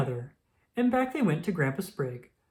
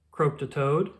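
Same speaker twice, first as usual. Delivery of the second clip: ordinary delivery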